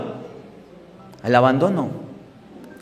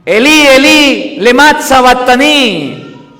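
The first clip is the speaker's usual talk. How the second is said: loud anguished cry, raised high; 4 semitones higher, 4 dB louder than usual. Acted. Jesus crying out from the cross, loud anguished cry. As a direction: call it out like a dying man. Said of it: "agobiado y gritó".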